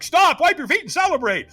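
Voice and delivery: high, urgent shouted instructions; called-out orders. Retold or imitated. Catchers greeting a firewalker at the end, high shouted orders. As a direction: call out each order up high.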